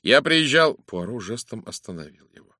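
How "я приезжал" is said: loud, raised protest, cut short; indignant cry. A narrator's reading. Actor protests until Poirot's gesture stops him, crying out. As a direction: burst out in self-defence. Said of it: "вскричал Брайан Мартин".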